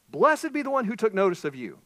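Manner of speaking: high, loud, sincere blessing; mean every word of it; heartfelt blessing. Acted. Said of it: "She means it".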